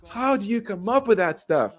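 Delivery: high-pitched, incredulous mock protest; amazed disbelief. Player retelling his amazement at a teammate's escape, high and incredulous.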